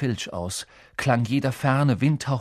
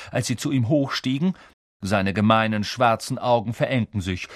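ordinary delivery, nothing marked

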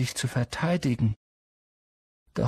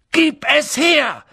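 high, loud, drawn-out shout; Schrei; 6 dB louder, slower than usual. A narrator's reading. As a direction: yell the demand, every word punched. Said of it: "schrie Harry". (lauter, wütender Schrei)

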